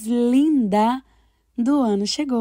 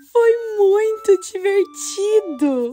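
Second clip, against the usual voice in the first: laughing; 10 semitones higher than usual. Acted. Laughing while telling the grandma story.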